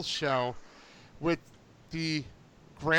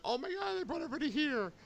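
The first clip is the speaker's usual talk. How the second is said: much higher, breathless excitement; retold giddy squeal; 9 semitones higher, 8 dB quieter, faster than usual. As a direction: squeal it, hushed but thrilled. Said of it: "and I was like".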